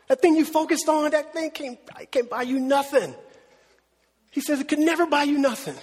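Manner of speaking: normal talking voice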